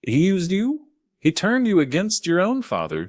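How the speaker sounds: pleading through rising tears; upset daughter. Acted. Daughter breaks down crying.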